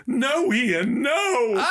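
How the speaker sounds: high, loud, laughing no; tiny blonde woman begging him to stop; small woman pleading. Retold or imitated. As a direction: squeak out a half-laughing plea. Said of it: "diminutive little blonde woman go".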